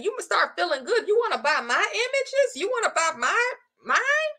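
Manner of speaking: a hint of a keen shopper asking to buy; mock customer voice. To the viewers, playing an eager buyer, lightly voiced. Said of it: "So when people start saying".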